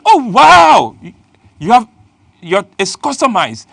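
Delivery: high, loud gushing wow; mock amazement. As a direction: pretend to be dazzled. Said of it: "so she goes".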